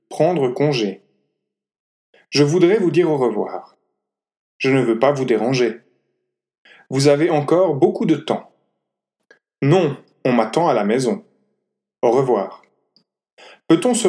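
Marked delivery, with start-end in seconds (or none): none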